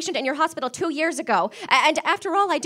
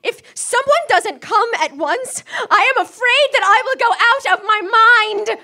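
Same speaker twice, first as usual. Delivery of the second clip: terrified woman begging for a nurse; panicky pleading; 4 semitones higher, 7 dB louder than usual. Acted. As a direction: voice rising as panic takes over. Frightened invalid pleading for help.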